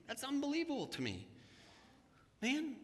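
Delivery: normal talking voice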